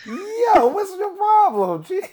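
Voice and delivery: high, loud and slow, mock tough. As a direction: squeak out a mock challenge.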